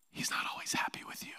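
hushed, whispered hard truth; confiding whisper. Real, not acted. Preacher to congregation after asking them to lean in, whisper. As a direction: whisper it as a secret to a leaning-in crowd.

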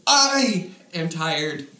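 I blasted loud and drawn out; slow mock announcement, booming on I; exaggerated first word. Shown as a demonstration. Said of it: "start every sentence like that".

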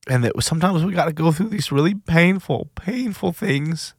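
high, tight voice close to tears, slowing on painful; getting choked up retelling a friend's comfort; voice breaking. Real, not acted. Telling listeners what his friend said, choked up and near tears. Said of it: "I'm gonna get emotional just thinking about it".

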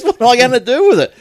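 high, loud imitated wail through laughter; laughing mock complaint. Seller stuck with a signed CD, loud mock complaint. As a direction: mimic a put-out seller, laughing.

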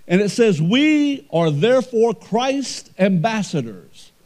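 loud, high, slow preacher's cry; booming pulpit delivery; full-force preaching. Acted. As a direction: preach it out to the whole church.

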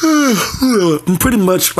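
slow words stretched by a yawn. Real, not acted. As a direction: yawn right through the words. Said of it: "I'm sorry for all the yawning".

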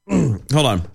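throat still catching after a cough; clearing the throat between words; coughing fit. Real, not acted. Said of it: "I'm sorry, I was coughing".

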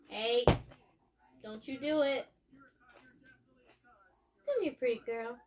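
calling out. Real, not acted. Scolding then calling the cat.

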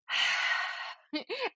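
high, rising copied exhale; imitating kid's sigh. Toddler copying mom's calming breath, high sigh. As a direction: mimic a small child copying the breath. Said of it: "they're, like, going".

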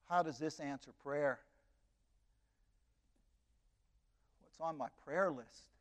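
a broken grandma asks God how this happened; anguished prayer. Retold or imitated. Grandmother realises she mixed up the gifts and pleads with God, hushed and grieving.

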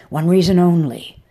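loud tirade. A narrator's reading. Woman loudly berating her client, harsh. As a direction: throw reason at him, harsh and loud. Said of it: "she continued her tirade".

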